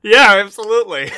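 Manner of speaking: high, very loud agreement; emphatic yell. Real, not acted. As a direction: shout it out with total conviction. Emphatic answer amid the laughter.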